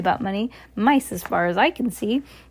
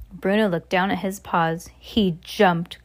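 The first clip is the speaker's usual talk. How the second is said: ordinary delivery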